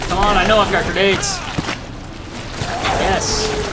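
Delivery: fast loud frantic rush. Real, not acted. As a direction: hurry it, near shouting. Scrambling for weapon mid-fight.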